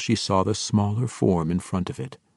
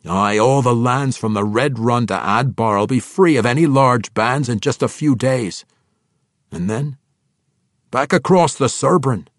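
grim; 4 semitones higher, 5 dB louder than usual. A narrator's reading. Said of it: "said Bruner grimly".

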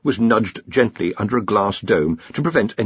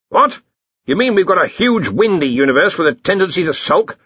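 higher, louder incredulous boss; Ridcully; 9 semitones higher, 5 dB louder than usual. Acted. Arch-Chancellor reacts to the lecturer's theory, incredulous. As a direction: burst out in disbelief.

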